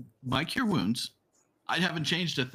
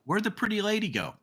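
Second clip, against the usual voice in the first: higher-pitched call without much force; half-yelled question, pitched high; mock yell; 8 semitones higher than usual. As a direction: call out in character, pitch swooping up. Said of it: "Theodulf yells out".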